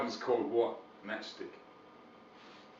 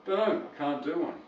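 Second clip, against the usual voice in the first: ordinary talk, nothing marked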